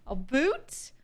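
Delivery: a boot, mock Canadian about; Canadian accent. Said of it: "You mean about?".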